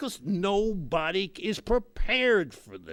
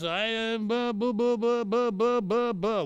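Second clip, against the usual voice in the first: stammering; 4 semitones higher, 4 dB louder than usual. Retold or imitated. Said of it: "What they would be saying is".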